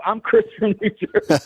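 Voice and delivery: high, laughing through the words; laughing while retelling how he introduced himself. Retold or imitated. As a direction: giggle out your own name. Retold self-intro, laughing.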